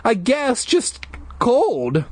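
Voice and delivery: put-on cold kid. Dana shivering.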